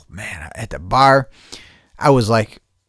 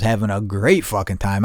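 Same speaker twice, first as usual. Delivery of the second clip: ordinary delivery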